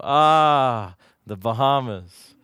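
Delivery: low, slow, longing echo of the word; dreamy sigh. Retold or imitated. Preacher echoing the girl, low and slow and dreamy. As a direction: savour it like a dream vacation. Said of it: "And I go".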